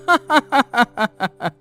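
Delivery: mocking staccato cackle; hammed-up scornful laughter; jeering laugh. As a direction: laugh at him derisively. Villager jeers at woodcutter.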